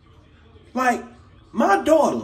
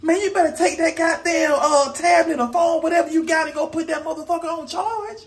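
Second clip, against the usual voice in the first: higher, exasperated shout; yelling at kid; 5 semitones higher than usual. Retold or imitated. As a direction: bark orders at the kid in disbelief. Father yelling at daughter.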